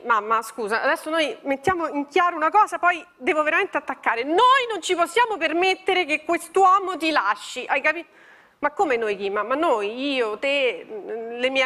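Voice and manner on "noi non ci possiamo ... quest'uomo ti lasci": ordinary talk, nothing marked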